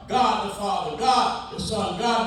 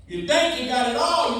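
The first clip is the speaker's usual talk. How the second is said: ordinary talk, nothing marked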